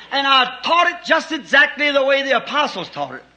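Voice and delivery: rising to a shouted climax; fiery preaching. Real, not acted. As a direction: hammer the point home from the pulpit. Preaching at full force.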